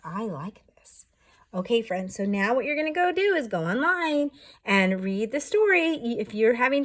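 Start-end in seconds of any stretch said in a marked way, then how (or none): 0.1-1.1 whispered. Acted. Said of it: "And he says".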